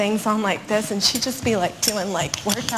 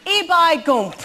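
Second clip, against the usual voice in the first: put-on Yorkshire accent; 5 semitones higher than usual. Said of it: "That's a Yorkshire accent".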